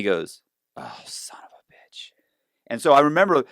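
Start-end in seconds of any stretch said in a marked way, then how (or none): 0.8-2.1 slow whispered curse; dawning dismay. Retold or imitated. Agent realizing the bank website was fake, whispered dismay.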